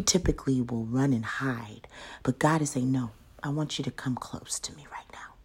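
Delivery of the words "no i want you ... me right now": hushed, fast whisper of God's call; intimate whisper. Acted. Preacher voicing God, whispering to a hiding Elijah. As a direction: breathe it close, hurried and secret.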